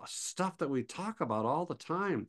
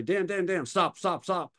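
higher, urgent repeated calling; 7 semitones higher than usual. Retold or imitated. Calling out to friend driving past the spot, urgent. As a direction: hurry him to pull over.

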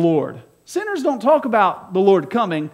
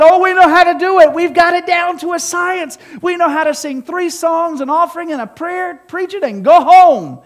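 high, loud mocking of smug churchgoers; hammed-up self-satisfied believers; smug churchgoers mocked; 6 semitones higher, 6 dB louder than usual. Preacher mocking his congregation as smug churchgoers. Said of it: "His people that said".

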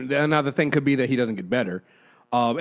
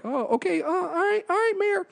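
meek high reply; 14 semitones higher, 4 dB quieter than usual. Retold or imitated. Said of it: "I'm like".

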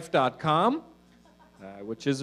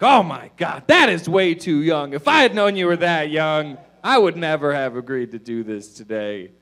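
mock freak-out; 3 semitones higher, 8 dB louder than usual. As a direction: throw her own outrage back at her. He throws her words back.

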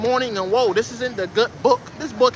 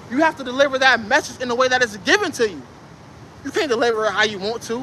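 normal talking voice

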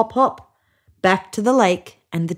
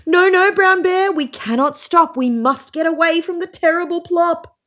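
animals pleading in fear; 10 semitones higher than usual. A narrator's reading.

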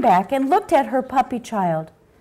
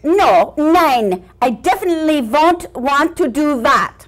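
emphatic, put-on German accent; German wiener dog; 3 dB louder than usual. Wiener dog refuses to give boy away, German accent.

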